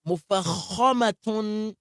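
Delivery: Arabic word said slow and high with full mouth; heavy tafkhim. Shown as a demonstration. Teacher demos tafkhim to class: مفخمة drawn out heavy. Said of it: "we give them isti'la".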